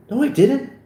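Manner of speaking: indignant comeback; defensive denial. Acted. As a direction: deny it sharply, pitch up. Acted quarrel between two friends.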